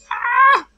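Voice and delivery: sharp high yelp of pain; pained cry. Real, not acted. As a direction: cry out from a stubbed toe. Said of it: "I just stubbed my toe".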